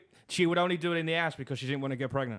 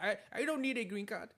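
put-on light high girly voice; coy little voice; girlish bride voice; 6 semitones higher, 7 dB quieter than usual.

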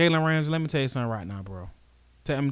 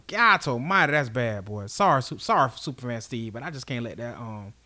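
ordinary talk, nothing marked

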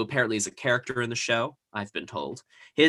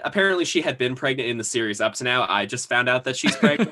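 ordinary delivery

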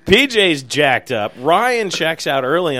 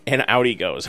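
usual speaking voice

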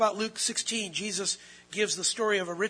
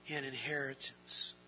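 whispered; 5 semitones lower, 9 dB quieter than usual. Acted. Repeated hushed climax.

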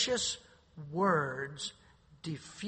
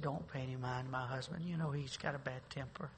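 softly spoken; 4 semitones lower, 6 dB quieter than usual. Retold or imitated. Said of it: "spoke softly".

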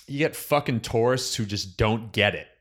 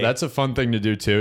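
ordinary talk

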